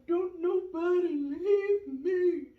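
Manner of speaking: put-on loud, high, drawn-out toddler wail; mock screaming cry. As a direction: wail slowly like an abandoned three-year-old, hammed-up. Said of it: "just hollering, screaming".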